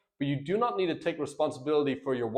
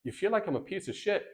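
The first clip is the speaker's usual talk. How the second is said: "like" lifted high, rest soft with a questioning rise; gently unsure question; curious questioning tone; 5 semitones higher, 4 dB quieter than usual. Shown as a demonstration. Coach role-playing the husband, softly mirroring. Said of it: "I'm gonna role play for you guys".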